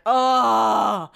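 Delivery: long drawn-out mock groan; exasperated groan. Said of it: "huffing and puffing and slamming things around".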